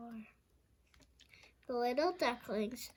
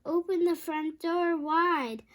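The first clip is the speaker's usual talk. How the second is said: calling out; 5 semitones higher, 5 dB louder than usual. A narrator's reading. Missus Duck calling out.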